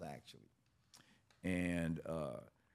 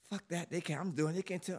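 much higher, defiant woman's voice; girlfriend's voice; 11 semitones higher than usual. Retold or imitated. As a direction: push back hard, higher and stubborn. Girlfriend voiced refusing to quit.

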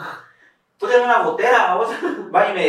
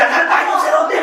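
brother snapping at him; 12 semitones higher, 6 dB louder than usual. Retold or imitated. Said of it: "Y me dice".

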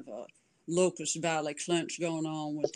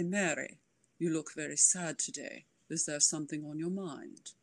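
a gentle, lowered preacher voice; William Brewster; 6 dB quieter than usual. Acted. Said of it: "talk like William Brewster".

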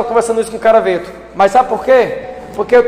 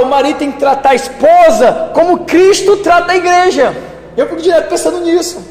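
pitched higher, pressing, driving on; fervent preaching; 5 semitones higher than usual. Real, not acted. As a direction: preach it with rising fire. Preacher rising in fervour.

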